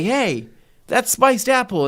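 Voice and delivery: high-pitched call without much volume; mild mock shout. Drink shouting its flavour in your ear, mild mock shout. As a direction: call it out like a half-hearted shout. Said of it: "like a person shouting in your ear".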